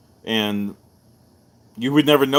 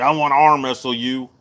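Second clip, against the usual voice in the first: a hint of a pushy drunk; lightly voiced barroom tough; bar guy challenge; 3 dB louder than usual.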